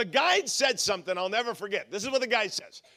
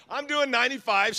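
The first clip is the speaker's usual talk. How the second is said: ordinary talk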